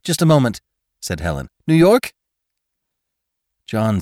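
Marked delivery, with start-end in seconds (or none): 0.0-0.6 high, loud telephone voice; raised, pitched-up phone request; calling into phone. A narrator's reading. Helen calls into the phone. 1.7-2.1 high, loud, rising query; raised telephone voice, questioning; calling into phone. A narrator's reading. Helen calls down the space phone.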